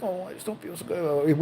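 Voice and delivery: soft, mumbled mild complaint; feeble old man. Acted. Old man tells the front desk he feels poorly, soft and feeble. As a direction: play a mildly poorly old man, softly. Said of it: "but he was like".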